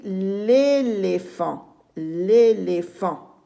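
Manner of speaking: "l'éléphant" read slowly as one word, the l joined to the vowel; elided l'éléphant. Shown as a demonstration. Teacher reading the elision to pupils, slow joined "l'éléphant". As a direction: draw out "l'éléphant" slowly, no "le" before it. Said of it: "Et je lis".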